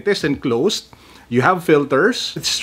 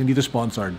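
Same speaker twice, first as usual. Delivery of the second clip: ordinary talk, nothing marked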